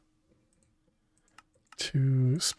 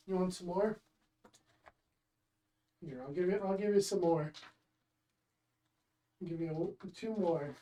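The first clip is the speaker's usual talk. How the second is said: pet talk; 5 semitones higher, 17 dB quieter, faster than usual. Real, not acted. Coaxing the cat with treats.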